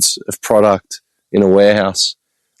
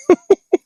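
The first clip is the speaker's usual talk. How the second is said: high-pitched cackle; short squealing laugh; high burst of laughter. Real, not acted. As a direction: let out a sudden high laugh. Laughing at own joke.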